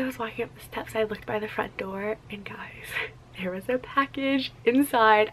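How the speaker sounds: excited hushed whisper. Real, not acted. Whispering to viewers about a surprise package. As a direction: lean in and whisper the secret. Secret package found at door.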